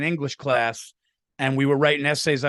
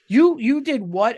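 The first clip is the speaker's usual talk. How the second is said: higher-pitched motherly disbelief; retold parental shock, pitched up; mock scolding mom; 6 semitones higher, 4 dB louder than usual. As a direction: imitate a mom's indignant reaction. Imitating mom confronting him over the report.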